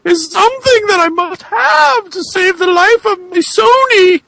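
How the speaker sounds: high, crying plea in a put-on voice; pleading high voice. Character begging the boogeyman for help, high wailing plea. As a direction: beg for help in a wailing squeak.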